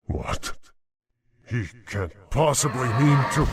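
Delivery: sudden high, fast alarmed gasp of words; shocked disbelief. Acted. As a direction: caught completely off guard. Demon realizes he's betrayed.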